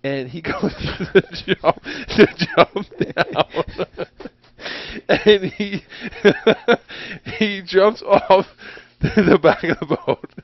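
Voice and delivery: cracking up while telling the story; high, halting words broken by real laughter; laughing. Real, not acted. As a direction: struggle to get the story out through giggles. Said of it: "can't even tell the story without laughing".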